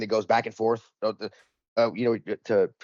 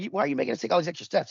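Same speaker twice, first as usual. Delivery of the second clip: high, fast retold complaint; exasperated complaint; 9 semitones higher, faster than usual. Traveler challenging the agent over extra walking, exasperated. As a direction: rattle off the objection in a raised pitch.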